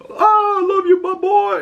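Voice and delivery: very high gushing teammate voice; gushing mock jock. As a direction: coo it way up like a doting buddy. Gushing imitation of Lonnie.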